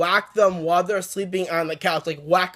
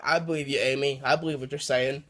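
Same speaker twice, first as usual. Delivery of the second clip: softer, lower, gently imitating a calm parent; mild hint of a reassuring parent, said softly; soothing parent voice; 5 semitones lower, 6 dB quieter than usual. Parents calming a scared Amy at breakfast, soft and gentle.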